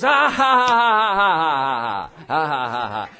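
loud laugh cascading down in pitch; demonstrated laugh. Shown as a demonstration. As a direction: show them how to laugh it off. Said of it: "Like this".

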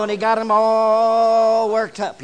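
loud, slow building emphasis; preacher swelling at the pulpit; rising preacher's swell. Real, not acted. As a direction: lean hard into all worked up.

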